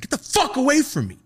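very high, loud shout; shouted warning. Retold or imitated. Student screaming at the substitute teacher. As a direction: scream it in his face.

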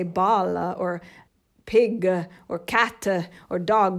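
each word ends on an added uh sound, Italian style; Italian accent. Shown as a demonstration. Mother demonstrating Italian learners adding vowels, Italian accent. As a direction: mimic an Italian tacking vowels onto English words. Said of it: "a, uh sound after every consonant".

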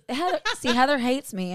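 usual speaking voice

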